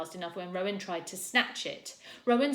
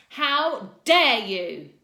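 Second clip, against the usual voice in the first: slow furious shout, much louder; enraged; 4 semitones higher, 13 dB louder, much slower than usual. A narrator's reading. Teacher repeating it in rage, slow furious shout. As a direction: drag each word out in rage. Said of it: "She was enraged too".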